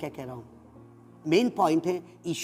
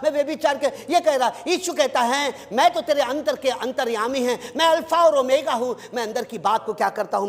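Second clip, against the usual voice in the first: raised, ringing sermon voice; full-force preaching; 5 semitones higher than usual. Acted. Pastor urging the congregation, preaching at full force. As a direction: preach it out to the whole church.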